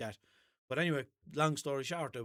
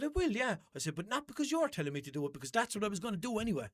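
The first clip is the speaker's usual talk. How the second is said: defiant retort; 8 semitones higher than usual. Retold or imitated.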